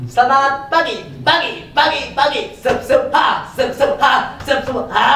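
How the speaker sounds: very high, loud belly-voice cheer. Shown as a demonstration. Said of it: "Bagaimana ya suara perut?".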